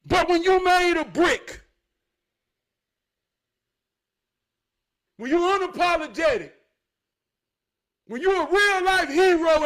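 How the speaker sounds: loud, rising preacher's fervor; preaching at full force. Acted. Preacher warning the flock about the wolf, fired up and loud. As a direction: preach it hard to the back row.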